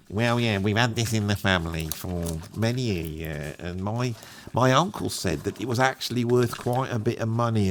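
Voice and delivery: a hint of a shy working-class man at the counter; quiet working-class owner. Working-class owner asking the posh expert for a price, soft and humble. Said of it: "lower middle class type".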